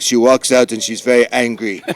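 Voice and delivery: ordinary talk, nothing marked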